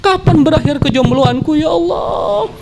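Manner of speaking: high-pitched mock wail at God; hammed-up lovesick crying prayer. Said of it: "antum nangis, menjerit". (meratap pura-pura dengan suara tinggi)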